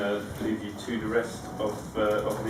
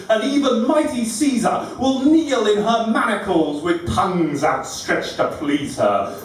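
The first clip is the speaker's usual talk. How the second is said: higher, louder measured declamation; dramatic poetry recital; 9 semitones higher, 7 dB louder, slower than usual. Acted. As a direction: declaim each rhymed line to the room. Recites a poem as graffiti writer.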